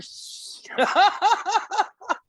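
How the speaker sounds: real high-pitched burst of laughter; laughing. Real, not acted. Podcast guest cracks up at own gas joke, laughing. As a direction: let out a long, high laugh. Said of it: "why are you laughing".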